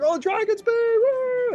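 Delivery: very high crowd-style cheer; crowd screaming. Acted. Everyone cheers the party down the street, high screaming. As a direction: whoop it like a street full of fans. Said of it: "everyone starts screaming Dragon's Bane".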